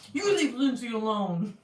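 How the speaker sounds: hammed-up growly scolding; mock-angry. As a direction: pretend to rage at someone. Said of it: "really angrily to, towards people".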